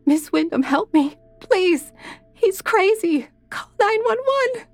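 high, desperate pleading; begging for my life. Acted. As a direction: beg for help in terror. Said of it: "followed by her pleading voice".

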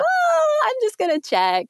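high, sing-song temptation; eager inner voice. Retold or imitated. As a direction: squeak it like a guilty impulse. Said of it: "be like".

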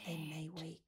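urgent hushed whisper; urgent resistance whisper. Real, not acted. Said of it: "Keep your voice down".

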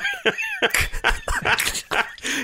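a high, cracking burst of laughter. Real, not acted. Laughing high at a co-host's joke about his dad. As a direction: let a giggle break out high.

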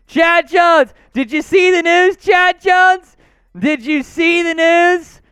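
high, loud, stretched-out hail; big excited shout, drawing out key words; excited hollering. Real, not acted. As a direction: holler it like a hype man greeting a star. Hailing a big donor on stream.

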